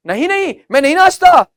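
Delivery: high, loud mock protest; emphatic refusal. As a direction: play an insistent refusal. Acts out a refusal answer. (ज़ोर देकर इनकार का अभिनय)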